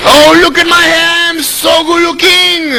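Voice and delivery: preen like you adore your mirror; mock vain preening. Kid admiring himself in mirror, mock vain coo. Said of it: "look at yourself and go".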